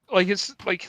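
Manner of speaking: ordinary delivery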